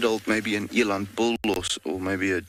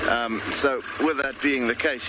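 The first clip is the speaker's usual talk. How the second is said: ordinary talk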